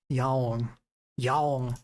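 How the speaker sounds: "Yaon" as YA-ong, falling; Korean pronunciation. Shown as a demonstration. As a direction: repeat "Yaon" low, like a Korean cat sound. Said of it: "let me check Korean".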